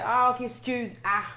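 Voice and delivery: mock the coworker's correction; coworker correcting. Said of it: "elle a répété".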